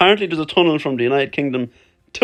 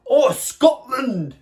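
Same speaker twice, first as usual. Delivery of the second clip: hammed-up Scottish accent, higher and drawn out; bad Scottish accent; 6 semitones higher, much slower than usual. Shown as a demonstration. Vlogger trying out Scotland before his trip, bad Scottish accent. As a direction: cry it out slowly in a terrible mock-Scottish accent. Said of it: "That was the worst Scottish accent ever".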